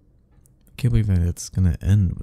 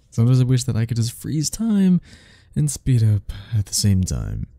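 ordinary delivery

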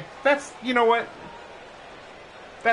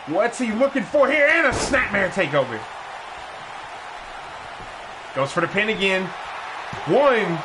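brisk ringside call, rising with the action; play-by-play commentary; 4 semitones higher than usual. Acted. Commentator calling the match for viewers, energetic play-by-play. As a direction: call the match like a wrestling announcer.

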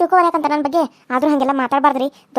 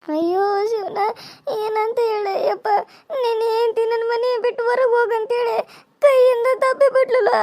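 higher, slow sobbing lament; tearful wailing; 4 semitones higher than usual. Acted. Mother-in-law weeping to her son that she was pushed. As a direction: sob out the complaint.

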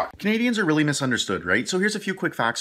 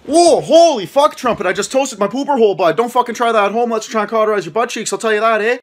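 pained yell; 3 semitones higher, 6 dB louder than usual. Acted. Man burned by the fire yelling in pain, loud and high. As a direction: yell after burning himself. Burning himself.

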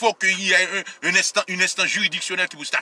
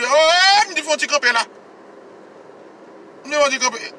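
louder, higher outcry to stop it; exclaiming; 8 semitones higher, 4 dB louder than usual. Real, not acted. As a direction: cry out that it must stop. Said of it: "he exclaims that it must stop". (rele byen fò pou di sa dwe kanpe)

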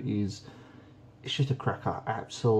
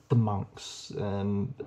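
ordinary talk, nothing marked

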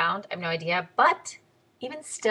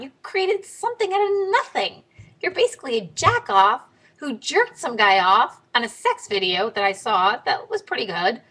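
higher, mock-praising voice dripping with sarcasm; sarcastic; 6 semitones higher than usual. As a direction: pretend to congratulate her while mocking. Sarcastic reply to Paris Hilton.

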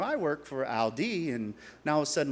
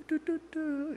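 high sung beeps of a phone dialing; dialing tones; 12 semitones higher, 7 dB quieter than usual. Retold or imitated. Dialing Les on the phone, high beeping tones. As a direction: chirp the dial tones up high.

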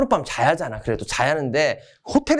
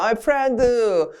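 higher, drawn-out buddy call; hammed-up hello to a friend; mock friendly greeting; 6 semitones higher, slower than usual. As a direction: stretch out the greeting to a pal. 미국의 친구 인사 흉내.

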